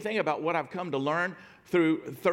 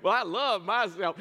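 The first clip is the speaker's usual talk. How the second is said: higher-pitched imitation of a southern drawl; 6 semitones higher, 4 dB louder than usual. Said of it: "in a little southern drawl".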